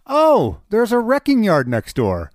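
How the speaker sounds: high, loud startled "oh" as a let-down buyer; mock surprise. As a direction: act out the shock of finding the junkyard next door. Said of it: "you're like".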